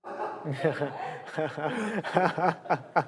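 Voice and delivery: long high laugh trailing down; hearty laugh. Real, not acted. Laughing at Canadian translation joke.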